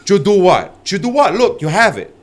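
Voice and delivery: high, teasing mock Brazilian accent. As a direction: goad them in a high-pitched put-on Brazilian accent. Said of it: "involved with having an accent".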